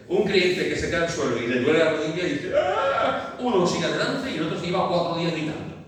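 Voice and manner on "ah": high mock cry of pain; mock yelp of pain. Preacher acts out a fallen believer crying out, a high mock yelp. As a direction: yelp as if the knee hurts.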